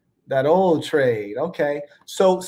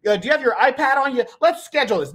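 higher-pitched mock scheduling voice; mocking, pitched-up appointment-making; mock eager planner; 6 semitones higher, faster than usual. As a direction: play an over-organized fighter, pitched up. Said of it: "it wasn't like".

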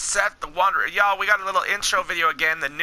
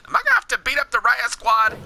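normal talking voice

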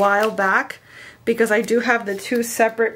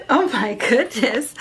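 laughing. Real, not acted. Amused at kid outside.

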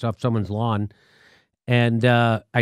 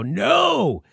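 mock yell; 6 semitones higher than usual. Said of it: "I just yelled no".

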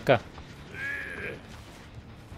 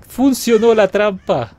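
loud, high, drawn-out cheer; excited exclamation; 6 semitones higher, 7 dB louder, slower than usual. Real, not acted. Player seeing the trap work, excited cheer. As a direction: cry out slowly and high in triumph. Trap has just caught someone.

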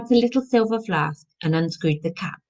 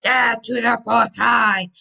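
slow, raised war cry; Viking yell; 5 semitones higher, 4 dB louder than usual. A narrator's reading. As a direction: yell it as a battle challenge. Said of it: "yelled Sigurd".

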